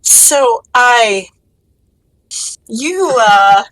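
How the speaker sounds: halting, tongue-tied princess; struggling for words. Acted. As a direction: stall on every word. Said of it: "Seeing the princess struggling for words".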